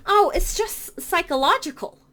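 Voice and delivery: ordinary talk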